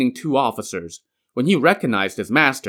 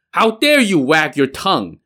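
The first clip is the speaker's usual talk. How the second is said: raised, angry rebuke; fuming; 5 semitones higher, 4 dB louder than usual. Acted. Master fuming at his pupil mid-fight, angry rebuke. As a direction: snap the question as you swing. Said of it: "Pure Essence fumed".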